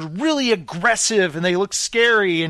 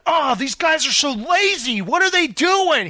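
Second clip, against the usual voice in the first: higher-pitched imitation of griping; mock complaining; 6 semitones higher than usual. Flipper griping about his lazy workers, mock complaining. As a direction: whine about the workers like an exasperated boss. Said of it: "He's always complaining".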